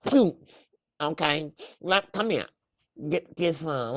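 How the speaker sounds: high, loud mock street hustler; hustler woman voice. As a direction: ham up a pushy liquor-store hustler. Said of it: "it's more like".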